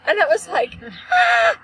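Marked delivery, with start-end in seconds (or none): 1.1-1.6 re-enacted loud, high shriek; short, piercing scream of a fit; hysterical scream. Daughter having a fit over mom's car, screaming. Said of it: "I was having a fit".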